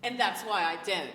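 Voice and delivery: loud, emphatic British accent. Shown as a demonstration. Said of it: "Could you not tell that I'm a Brit".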